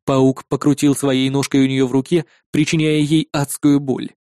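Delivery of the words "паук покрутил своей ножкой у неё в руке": usual speaking voice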